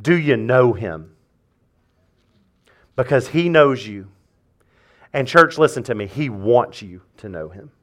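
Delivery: hushed pastor voice; hushed intimate preaching. Acted. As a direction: speak it softly like a secret. Quiet opening of sermon.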